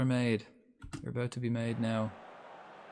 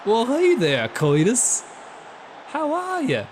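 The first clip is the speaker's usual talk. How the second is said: loud, high-pitched cartoon American; exaggerated Yankee cartoon hello, swooping high; Goofy; 10 semitones higher, 7 dB louder, slower than usual. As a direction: belt out a daft Disney-character greeting. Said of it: "What accent has Goofy got from Disney?".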